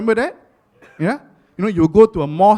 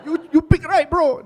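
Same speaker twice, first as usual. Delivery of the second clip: much higher teasing bro voice; mock praising bro; 10 semitones higher than usual. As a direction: ham up a guy complimenting the pick. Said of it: "Like, like".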